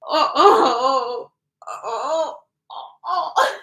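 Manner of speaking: imitated "oh, oh" groans; mock groans. Acting out the prankster brother, mock groaning. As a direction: groan out mock ohs, rising at the end. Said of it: "he goes".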